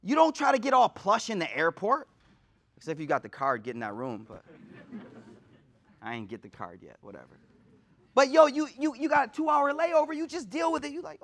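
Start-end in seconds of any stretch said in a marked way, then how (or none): 2.8-7.4 tossed off under the breath; muttered aside. Real, not acted. Joking aside to the congregation about the lounge card, much softer and lower.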